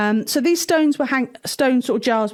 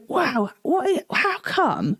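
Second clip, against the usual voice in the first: higher, slow, stunned mock disbelief; astonished taster; 4 semitones higher, slower than usual. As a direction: play the guest who can't believe the wine. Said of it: "And the guy went".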